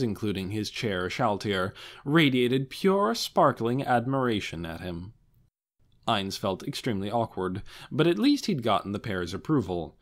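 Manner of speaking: usual speaking voice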